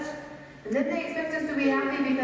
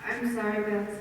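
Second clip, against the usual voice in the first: normal talking voice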